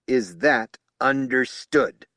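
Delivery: loud, slow menacing question; growled. Acted. Spokesman growling at the officers, slow and menacing. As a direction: growl the warning. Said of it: "he growled".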